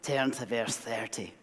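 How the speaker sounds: imitating a Scottish preacher's accent, slow at the start then rising; Jim McVicker Scottish accent. Pastor imitates Scottish preacher Jim McVicker, mock Scottish accent. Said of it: "what I wanted to talk like Jim".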